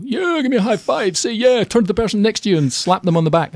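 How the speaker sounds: mock an over-the-top American hype man; hyped American motivator. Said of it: "which is great for Americans".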